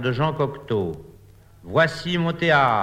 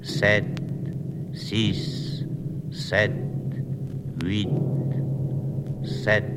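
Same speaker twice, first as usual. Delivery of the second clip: low, faraway number recitation; distant voice; 4 semitones lower, 4 dB quieter, slower than usual. Acted. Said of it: "d'une voix lointaine".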